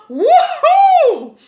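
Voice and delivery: high, loud laughing woohoo; joyful whoop. Real, not acted. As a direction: let the joy burst out in a cheer. Joyful outburst while testifying.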